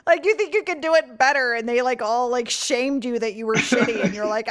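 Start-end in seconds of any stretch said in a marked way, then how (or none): none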